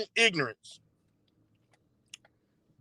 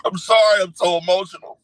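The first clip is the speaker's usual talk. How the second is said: mock crying. Streamer imitating a man about to be fired, mock crying. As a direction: apologise as if overcome, badly faked. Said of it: "did you see me crying?".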